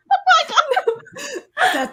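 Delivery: high, squealing laugh through the words; overwhelmed laughing outburst. Real, not acted. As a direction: crack up completely while saying it. Cracking up at the story.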